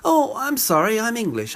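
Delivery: high-pitched, fake-innocent apology; mock apology. Englishman handing over the bathroom, mock apologetic. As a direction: pitch it way up, sorry not sorry.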